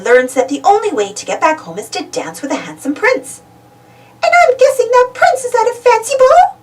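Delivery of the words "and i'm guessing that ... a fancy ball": high, bright little mouse voice; sweet high-pitched Minnie; Minnie's voice. Acted. Minnie suggesting a story to friends, high mouse voice. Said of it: "asks Minnie-rella".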